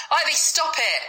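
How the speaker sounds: high sharp telling-off; scolding the dog. Acted. Homeless man tells his dog to stop, sharp and high. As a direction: snap the dog out of it.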